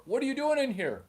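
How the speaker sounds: high, startled demand; acted parental shock; dad bursting in. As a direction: burst in on your son with a high-pitched question. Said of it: "I unlock the door".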